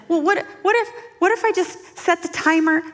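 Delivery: high, stammered suggestion; hammed-up nervous new mother; mock-anxious mom. New mother suggests a timer to mentor, mock anxiety.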